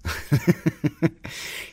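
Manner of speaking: short breathy burst of laughter; amused high laugh. Real, not acted. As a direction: laugh at your own joke. Laughs at own endorsement joke.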